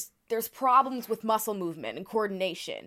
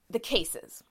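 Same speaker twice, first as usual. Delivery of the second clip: ordinary talk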